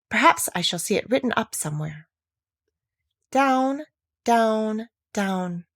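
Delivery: ordinary delivery, nothing marked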